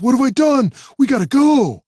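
high, loud, alarmed; rising panic, urgent; panicked. Acted. Jimmy panics.

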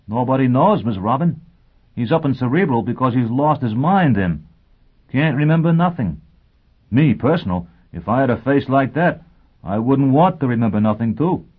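lively, emphatic rough-grammar sergeant; plain-spoken sergeant. Acted. Sergeant Logan answering Miss Robin about Foyle, blunt and chatty.

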